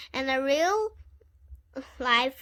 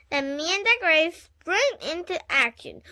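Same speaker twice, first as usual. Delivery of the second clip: ordinary delivery, nothing marked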